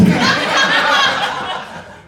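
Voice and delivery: high breathless wheeze of laughter; burst of laughter. Real, not acted. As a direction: crack up helplessly.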